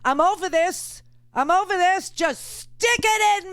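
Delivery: high, loud fed-up outburst; exasperated friend. Retold or imitated. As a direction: shout it like you're completely over it. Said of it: "somebody said".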